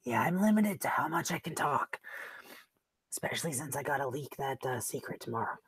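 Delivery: hoarse, half-whispered voice; strained, failing voice; losing voice. Real, not acted. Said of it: "you'll have to excuse my voice".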